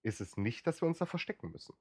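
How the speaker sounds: normal talking voice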